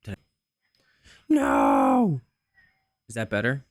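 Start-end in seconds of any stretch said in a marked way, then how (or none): 1.3-2.2 sudden loud high no; frustrated shout. Real, not acted. Streamer testing mic settings, shouts at a mistake. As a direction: yelp it out in dismay.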